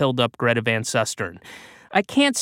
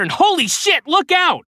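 much higher, loud alarm; shouted warning; 6 dB louder than usual. A narrator's reading. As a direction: yell a sudden warning. Sudden danger warning.

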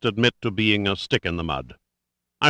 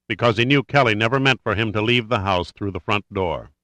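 usual speaking voice

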